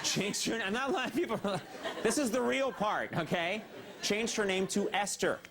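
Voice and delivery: high-pitched protest to a doubting crowd; insisting. Real, not acted. Host insists to doubting audience.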